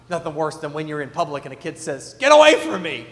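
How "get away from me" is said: put-on loud kid's yell; mock yell. Retelling a kid yelling at dad in public. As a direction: shout it like an embarrassed kid.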